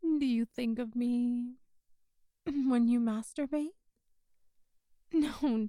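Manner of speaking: ordinary talk, nothing marked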